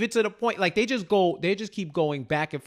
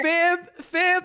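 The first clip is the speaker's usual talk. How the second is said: mock alarmed; 12 semitones higher than usual. Reacting to lip gloss.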